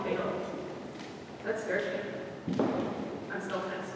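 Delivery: quiet, tense reading of a poem; soft poem reading. Acted. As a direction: read it softly, wound up with nerves. Reads anxiety poem softly.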